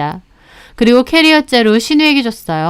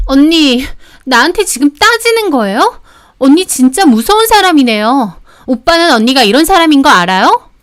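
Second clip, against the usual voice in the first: mildly hammed-up affronted sister-in-law, pitched higher; flustered indignation; 5 semitones higher than usual. Flustered sister-in-law challenging the wife who told her off, indignant. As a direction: protest in a higher, wounded voice. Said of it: "얼굴이 발갛게 달아올라서 우물쭈물".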